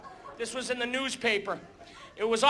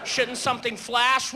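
normal talking voice

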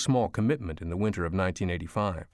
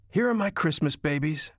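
higher-pitched, doting exclamation; mildly delighted cry; 6 semitones higher than usual. A narrator's reading. Grandmother greeting her little granddaughters, crying out with mild delight. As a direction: lift the pitch in fond delight, without shouting. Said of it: "Mom cried".

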